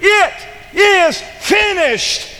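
high, loud, slow proclamation; triumphant shout. Acted. Preacher voicing Jesus's cry from the cross, shouting in triumph. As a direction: cry it out in victory. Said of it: "He said".

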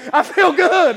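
loud and high; soulful declaration. Shown as a demonstration. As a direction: belt it out from the soul. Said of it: "I'm not talking about [name]".

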